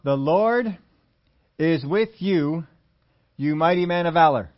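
slow, weighty, each phrase given space; solemn scripture reading. Acted. Preacher reading the angel's greeting, slow and solemn. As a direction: proclaim it like a blessing. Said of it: "There it is, verse twelve".

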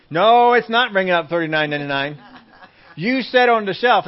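loud protest with a long NO; acted outraged objection; indignant customer. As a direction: argue back loudly at the cashier. Acted customer arguing with cashier.

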